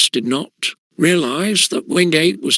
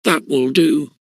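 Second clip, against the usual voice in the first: snapping. A narrator's reading. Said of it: "snapped Mister Quelch".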